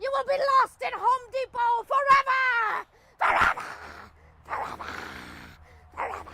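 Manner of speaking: rising doom-laden hex, shouted at the end; laying a curse. Acted.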